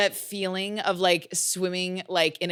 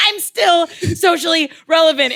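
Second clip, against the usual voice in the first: high, loud indignant retort; indignant shout; 9 semitones higher, 6 dB louder than usual. Acted. Jennifer hitting back at Needy's jab, indignant shout. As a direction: shout it back, wounded pride on show.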